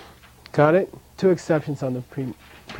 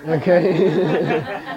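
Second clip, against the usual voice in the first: word breaking into laughter; laughing; 2 semitones higher than usual. Real, not acted. Teacher sharing a laugh with students, laughing. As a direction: say it through a real laugh.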